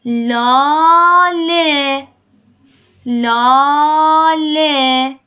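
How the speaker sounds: لاله drawn out very slowly and loud; very slow, loud demo of لاله; slow teaching voice. Shown as a demonstration. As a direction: model لاله slowly for learners. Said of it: "نگاه کن".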